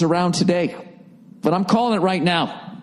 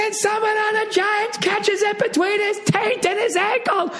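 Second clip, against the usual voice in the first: much higher, slower hammed-up play-by-play; sportscaster; slower than usual. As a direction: call the game like an overexcited TV announcer. Mock sports commentary.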